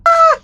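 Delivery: sharp high yelp of shock; startled scream. Real, not acted. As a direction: cry out as you get killed. Killed by imposter in game.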